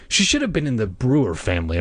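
ordinary delivery, nothing marked

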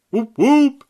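high, loud siren "whoop, whoop"; mock siren whoop. Real, not acted. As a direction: sound the clown alarm.

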